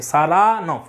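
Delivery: সারানফ with "ra" drawn out; stress on second syllable. Shown as a demonstration. Showing Petkoff's pronunciation, সারানফ stressed on "ra". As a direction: lean on the middle syllable of সারানফ. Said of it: "second সিলেবেলে জোর দিচ্ছেন".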